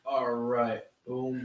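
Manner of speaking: ordinary delivery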